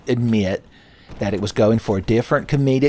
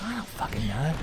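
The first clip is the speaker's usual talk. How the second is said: hushed, unvoiced whisper; 4 semitones higher, 12 dB quieter than usual. Student whispering back to the cute girl in class, hushed. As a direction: whisper it so the teacher can't hear.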